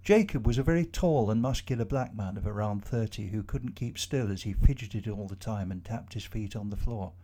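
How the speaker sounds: plain reading voice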